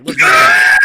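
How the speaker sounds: sudden high excited yell; hype shout. Real, not acted. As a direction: shout it like a DJ calling a rewind. Hype call to rewind.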